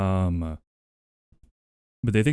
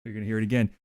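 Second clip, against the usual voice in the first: usual speaking voice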